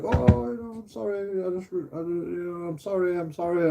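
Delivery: high whiny mock sorry; mock girlfriend apology. Mocking his girlfriend apologising after punching him, high whiny. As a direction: whimper it like a fake apology. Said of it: "she's like".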